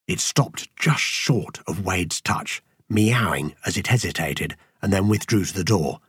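ordinary delivery

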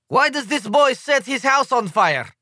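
put-on demanding, in a thick Slovenian accent; a Slovenian delegate demands, thick accent. A narrator's reading. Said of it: "in a thick Slovenian accent".

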